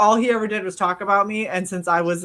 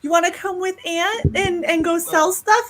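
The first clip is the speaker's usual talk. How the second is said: coaxing; 8 semitones higher than usual. Retold or imitated. Aunt coaxing her niece.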